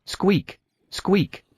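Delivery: high tiny newborn rabbit squeaks. Acted. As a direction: squeak like faint newborn bunnies. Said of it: "The faint cries of the newborn".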